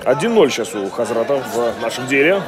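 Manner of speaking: ordinary talk, nothing marked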